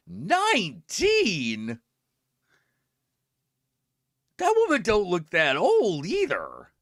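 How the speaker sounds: high, very drawn-out stunned question; shocked disbelief. Retold or imitated. She reacts to the nineteen kids, stunned. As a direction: gape at the number. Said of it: "And I'm like".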